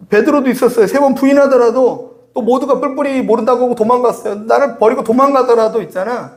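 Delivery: usual speaking voice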